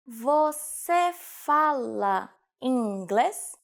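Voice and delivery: very slow, word by word; slow teaching repetition. Shown as a demonstration. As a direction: draw out "Você fala inglês" syllable by syllable for learners. Teacher repeats phrase slowly for learners. (slowed-down language-lesson model)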